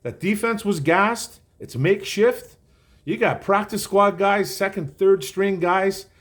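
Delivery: a light imitation of the coach explaining; quoting Rex Ryan. Host imitating Rex Ryan defending the coach's call. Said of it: "He's like".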